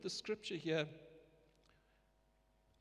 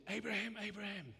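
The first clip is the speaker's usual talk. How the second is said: higher, tender double call of the name; the Lord calling Abraham fondly; 5 semitones higher than usual. Retold or imitated. God stops Abraham from killing his son, tenderly. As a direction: call him by name with endearment. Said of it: "the Lord said".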